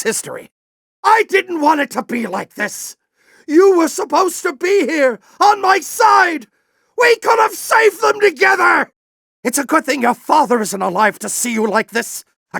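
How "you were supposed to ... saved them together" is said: betrayed shout. Acted. General shouting at Ratchet for not siding with him.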